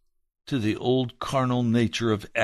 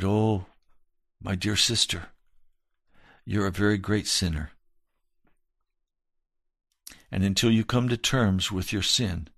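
tender rebuke; 4 semitones lower than usual. Retold or imitated. Pastor answering the woman, low and tender. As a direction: gently tell her the hard truth. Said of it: "I said".

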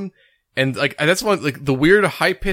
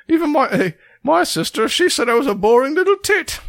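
imitating a high-pitched Yorkshire accent; squeaky Yorkshire whine about his sister; 12 semitones higher than usual. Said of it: "high-pitched, like, Yorkshire accent".

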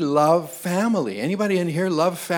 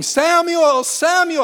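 higher, louder calling for a lost son; shouting a name; 14 semitones higher, 6 dB louder, slower than usual. Retold or imitated. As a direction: call out the boy's name in panic, loud and high. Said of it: "I'm shouting at the top of my voice".

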